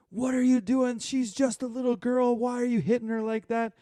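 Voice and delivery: high, loud outraged shriek at the catcher; angry mom yelling. Retold or imitated. Coach yelling at a young catcher, high and outraged. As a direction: screech it like a furious sideline mom. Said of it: "this lady is just, like, pointing at our catcher".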